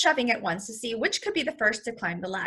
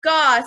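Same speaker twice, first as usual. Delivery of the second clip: loud penguin grunt; penguin grunt gaw; 4 semitones higher, 8 dB louder than usual. A narrator's reading. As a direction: let out a loud penguin caw. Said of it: "said Columbus".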